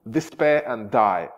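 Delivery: slow, heavy Despair and die; ghosts' curse. Acted. Ghosts cursing Richard, slow solemn intoning. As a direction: intone it like a spectre. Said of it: "chacun des spectres lui dit".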